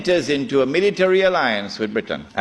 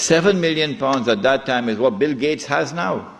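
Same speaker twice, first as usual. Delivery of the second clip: normal talking voice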